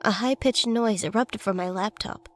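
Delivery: ordinary delivery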